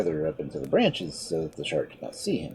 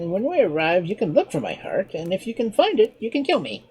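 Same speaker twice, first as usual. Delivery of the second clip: higher, lighter voice for the sly monkey; clever monkey; 12 semitones higher, 6 dB louder than usual. Acted. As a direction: play the monkey, a touch mocking. Monkey's long explanation.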